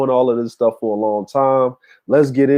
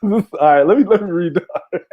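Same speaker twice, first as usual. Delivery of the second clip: laughing; 5 semitones higher than usual. Real, not acted. Laughing at the photo.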